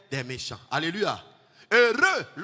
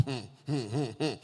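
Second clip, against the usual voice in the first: low murmured hum, lips closed; meditative humming; 6 semitones lower, 8 dB quieter than usual. Shown as a demonstration. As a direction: hum softly to yourself. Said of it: "de murmurer". (hum hum fredonné bas)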